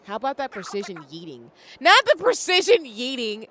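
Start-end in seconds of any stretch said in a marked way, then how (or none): 1.8-3.5 slow, much higher and louder protest; drawn-out laughing protest; amused outcry. Real, not acted. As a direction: cry out in mock dismay. Reacts to a silly game line.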